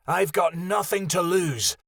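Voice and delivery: high, fierce beast voice; growl. Acted. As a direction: snarl it out with nothing left. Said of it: "Lilja growled".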